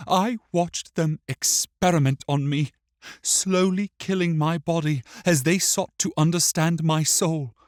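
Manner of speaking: put-on high child's voice, bitterly recounting; wounded, accusing boy. A narrator's reading.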